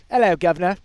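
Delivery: loud, playful mock British accent; British "guvnor" accent. Throwing an accent into a talk about governors, mock British. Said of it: "I just wanna throw this accent in there".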